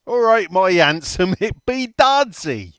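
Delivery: high, loud, slow mock Welsh greeting; hammed-up Welsh accent, drawn out. Telling his sister about the bloke, in a hammed-up Welsh accent. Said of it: "where you did the Welsh voice".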